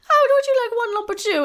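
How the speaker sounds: high, loud coy mock-flirt; giggly sixties lady. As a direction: play the ditzy swooning sidekick. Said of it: "she's like".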